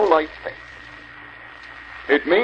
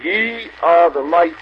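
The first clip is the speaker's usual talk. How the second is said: slow, weighty "Ye are the light"; weighty scripture reading; slower than usual. Shown as a demonstration. Preacher reads scripture for brethren to finish, weighty and slow. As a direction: give every word weight, drawing it out. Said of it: "There's weight in every word in it".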